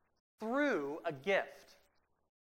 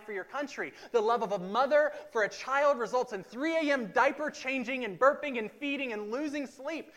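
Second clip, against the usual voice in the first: preaching; 6 semitones higher, 7 dB louder than usual. Acted. Preaching build.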